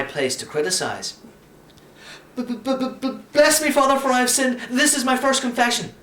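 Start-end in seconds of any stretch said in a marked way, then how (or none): 2.4-5.9 stammered start, then fast and higher; nervous boy gabbling it all out; rattled off in one breath. A narrator's reading. Boy gabbles his first confession, fast and nervous. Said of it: "I rattled off all in one breath".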